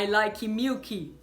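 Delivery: slow Brazilian-accented learner English. Shown as a demonstration. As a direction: Brazilian LIKE-ee MIU-kee. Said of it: "três errinhos aí".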